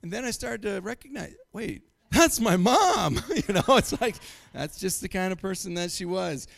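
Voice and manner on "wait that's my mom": louder, slow, drawn-out realization; slow dawning realization. Retold or imitated. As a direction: let it dawn on you slowly. Recognizing mom as the witch.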